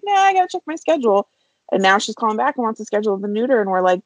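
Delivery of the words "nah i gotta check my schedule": quick, high-pitched imitation of a dismissive client; mock brush-off. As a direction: toss it off fast and high, mimicking a casual refusal.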